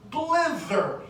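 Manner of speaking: blither said as BLITH-er, with an extra er syllable and a high pitch jump; student's mispronunciation. Retold or imitated. Student from Taiwan misreading the word aloud, blither with an extra syllable. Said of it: "read the word highlighted here as".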